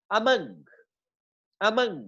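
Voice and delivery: Among as light "uh", stressed "MUNG"; second-syllable stress. Shown as a demonstration. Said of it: "second syllable पे होगी".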